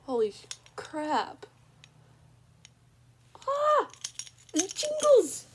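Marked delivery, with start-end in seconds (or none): 3.5-3.9 high delighted squeal; excited squeal. Real, not acted. Squeal at jingling collar. 4.5-5.5 high, loud squeal of delight; squealing excitedly. Real, not acted. Delighted at jingling collar.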